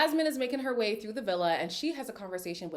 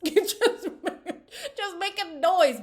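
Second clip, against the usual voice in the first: high, loud, through laughter; laughing outburst; 10 semitones higher, 6 dB louder, much slower than usual. Real, not acted. Host laughing at two firecrackers together, loud outburst. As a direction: crack up and belt it out.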